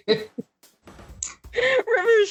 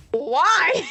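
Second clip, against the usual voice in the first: bewildered why; 4 semitones higher than usual. Acted. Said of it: "turns directly at Oswald and goes".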